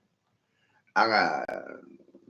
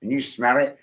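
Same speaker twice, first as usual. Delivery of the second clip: ordinary delivery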